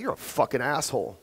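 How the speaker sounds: low, cold, cutting delivery; harsh insult. Shown as a demonstration. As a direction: say it like you mean the insult. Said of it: "you see the difference?".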